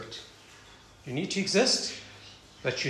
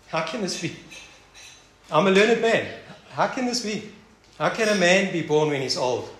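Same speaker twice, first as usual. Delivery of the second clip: incredulous Nicodemus; 4 semitones higher, 5 dB louder than usual. Acted. Nicodemus voiced in disbelief.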